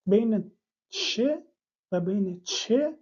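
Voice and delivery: ش and چه set against each other slowly; teacher contrasting ش and چه. Shown as a demonstration.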